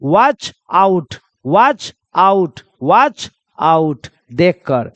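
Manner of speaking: "Watch out" split into two separate, drawn-out words; slow careful repetition. Shown as a demonstration. Teacher telling pupils to listen closely, "Watch out" slow and separated. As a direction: say "Watch", pause, then "out", slowly each time. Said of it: "ध्यान से सुन लेना बच्चा".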